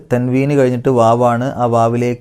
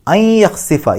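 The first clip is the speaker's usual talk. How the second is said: higher chanted phrase; Quran recitation; 8 semitones higher, much slower than usual. Shown as a demonstration. Teacher recites the verse phrase. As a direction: sing the phrase out. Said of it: "സുക്കൂണിലെ നൂന്".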